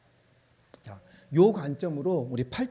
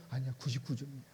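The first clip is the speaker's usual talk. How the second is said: softer, calm correction; quiet God voice; 4 semitones lower, 10 dB quieter, faster than usual. Acted. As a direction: gently set him straight.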